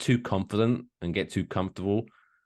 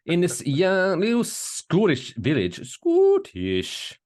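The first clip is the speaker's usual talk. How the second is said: drawn-out, high hammed-up Scottish accent; mock Scottish accent; 8 semitones higher, 3 dB louder, slower than usual. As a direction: stretch it into a broad Scottish lilt. Hammed-up Scottish place names.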